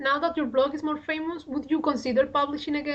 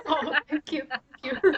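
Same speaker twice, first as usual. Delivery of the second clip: laughing thanks; 2 semitones higher than usual. Real, not acted. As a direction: thank you through real laughter. Laughing thanks after teasing answer.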